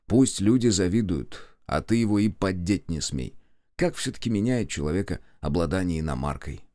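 plain reading voice

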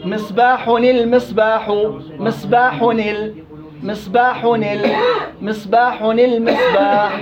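"مصباح المصباح" drawn out slowly, the tanween sounded as "نل"; slow recitation demo. Shown as a demonstration. Asked to perform it again, teacher recites slowly. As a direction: recite "مصباح نل مصباح" deliberately, syllable by syllable. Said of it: "كمان مرة أداء".

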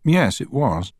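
mildly defensive witness; slight edge. A narrator's reading. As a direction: answer a touch testily. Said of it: "with a slight edge to his voice".

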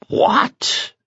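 a sky-high indignant What; towering outrage. A narrator's reading. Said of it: "in a towering tone".

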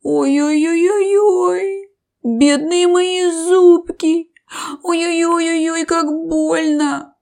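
high, slow, drawn-out moans; loud slow wailing lament; moaning crocodile. Acted. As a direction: groan in pain over sore teeth. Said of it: "как стонет крокодил".

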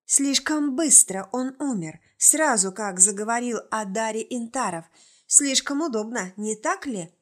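usual speaking voice

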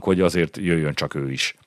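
ordinary delivery

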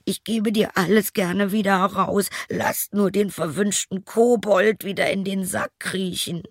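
hohe, gequetschte Wirtsstimme; kleinlaut flehend. Acted. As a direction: um Gnade winseln. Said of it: "rief der Wirt ganz kleinlaut". (kleinlaut flehender Wirt)

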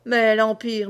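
usual speaking voice

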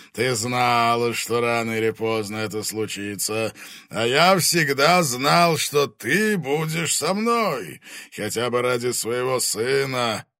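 menacing fire dragon voice; booming arrogant dragon. Acted. Dragon speaks.

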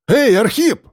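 high-pitched call to someone. A narrator's reading. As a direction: call out to get his attention. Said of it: "крикнул участковый". (громкий окрик)

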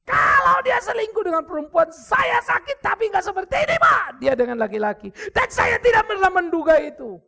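high, loud, wailing outcry of a betrayed wife; anguished shout. Retold or imitated. Pastor voicing the betrayed wife's outburst, anguished high shout. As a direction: cry it out as if heartbroken.

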